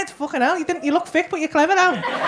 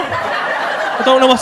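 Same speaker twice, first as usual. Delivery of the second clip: usual speaking voice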